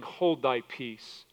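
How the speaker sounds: low, slow, hushed command; a slow, soft rebuke, low and firm; quiet rebuke. Retold or imitated. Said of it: "And Jesus rebuked him".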